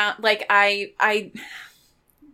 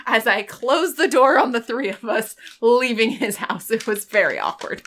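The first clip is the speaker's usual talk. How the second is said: ordinary talk, nothing marked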